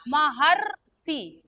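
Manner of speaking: महर्षि said very slowly, the र blended into the ह; drawn-out pronunciation. Shown as a demonstration. Teacher showing the class how to say महर्षि, very slowly. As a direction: stretch out महर्षि so every sound is heard. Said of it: "इसका उच्चारण करते हैं तो क्या बोलते हैं".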